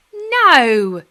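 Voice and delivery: loud, firm flat no. Acted. Girl answering the nervous menfolk, firm. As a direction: shut it down with one firm word. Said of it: "said Polly firmly".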